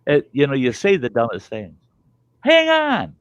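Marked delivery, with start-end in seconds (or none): 2.4-3.1 high pitched "hang on"; a dad yelling at his family in a sliding car; retold yell. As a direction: yell it in a panic. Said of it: "I yell".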